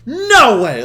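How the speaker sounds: high, loud cry of disbelief. Retold or imitated. Caught by his mom at night, loud disbelief. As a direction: blurt it out at full volume. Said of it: "I'm saying this shit out loud".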